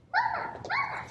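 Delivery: very high squeaky little gosling; baby gosling voice. Acted. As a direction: squeal it like a newborn chick. Newborn gosling calls robot.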